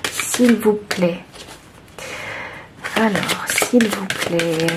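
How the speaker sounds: slow, soft, drawn-out please; hushed pleading. Real, not acted. Reader softly begging the deck to release a card. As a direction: coax the card out gently under your breath.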